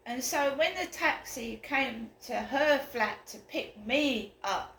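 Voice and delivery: ordinary delivery, nothing marked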